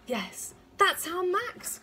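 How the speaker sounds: high, loud, drawn-out joyful recognition; loud excited mother's cry; cried out. A narrator's reading. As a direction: exclaim it slowly and high. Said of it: "cried Ma".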